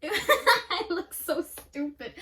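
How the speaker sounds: laughing through the words; giggling at herself. Real, not acted. Laughing at her own makeup look.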